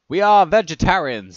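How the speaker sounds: ordinary delivery, nothing marked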